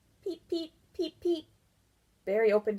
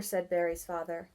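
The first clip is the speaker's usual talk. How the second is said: ordinary delivery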